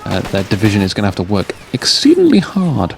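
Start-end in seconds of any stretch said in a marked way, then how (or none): 1.7-2.9 "exceedingly" stretched and sung up high; drawn-out EXCEEDINGLY. Real, not acted. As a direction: lean on "exceedingly" with a raised, held pitch. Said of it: "especially like that".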